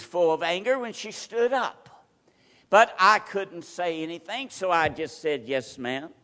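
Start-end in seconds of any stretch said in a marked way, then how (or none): 5.5-6.1 a hint of a soft, humble yes, lower and softer; meek yes ma'am. Preacher meekly answering an angry church lady, softly. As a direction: answer like a small innocent child. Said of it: "Very kind, this little innocent face".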